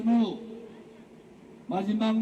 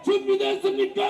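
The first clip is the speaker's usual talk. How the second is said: higher rally shout; 9 semitones higher than usual. Real, not acted. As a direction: push the repeat higher and harder. Calls crowd again. (더 높이 외치는 재촉)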